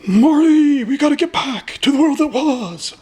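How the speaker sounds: high, pleading mock old-timer voice; nostalgic old-timer. Quoting a nostalgic gamer.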